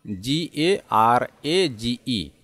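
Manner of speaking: normal talking voice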